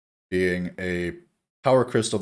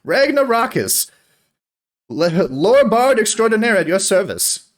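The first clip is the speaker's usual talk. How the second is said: high, theatrical bardic flourish; grand self-introduction; 8 semitones higher, 4 dB louder than usual. Acted. Old bard introducing himself to the party, high and grand. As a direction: announce yourself with pomp. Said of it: "and goes".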